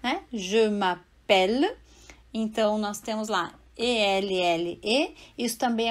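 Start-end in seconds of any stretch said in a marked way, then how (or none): 0.3-1.8 slow m'appelle with an open E, mapɛl. Shown as a demonstration. Teacher to student: slow French m'appelle. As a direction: draw out m'appelle to show the open E. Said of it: "duas consoantes".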